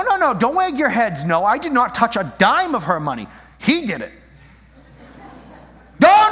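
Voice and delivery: loud, high-pitched mock outrage; indignant denial. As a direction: protest your innocence loudly, acting offended. Acting out indignant denial of theft.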